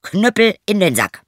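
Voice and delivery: high, loud command; called out. Acted. Turner orders the cudgel back into the sack, loud call. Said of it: "Dann rief er".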